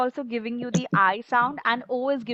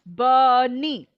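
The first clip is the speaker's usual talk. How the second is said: slow BUNNY in two even chunks; robot talk; 4 dB louder than usual. Shown as a demonstration. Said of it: "I talk like a robo".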